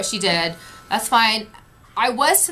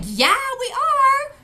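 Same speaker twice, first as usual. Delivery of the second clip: high, drawn-out shouted reply; slow, high mock yell; imitated crowd yell; 13 semitones higher, slower than usual. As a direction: holler back as the irritated crowd. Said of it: "screaming and yelling".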